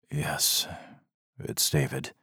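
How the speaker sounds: soft, flat, drained answer; husband tells his wife their son is dead, monotone. A narrator's reading. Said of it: "His voice was monotone".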